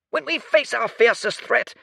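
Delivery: a reedy officer pushing his claim; shrill squawk. Acted. Bin arguing for the military, high and shrill. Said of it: "his voice was but a shrill squawk".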